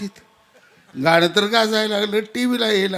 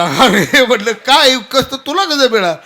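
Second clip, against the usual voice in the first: high, louder laughing disbelief; retold astonishment; 6 semitones higher, 6 dB louder than usual. Telling the son about his earnings, in laughing disbelief. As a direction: act amazed at the money, half laughing.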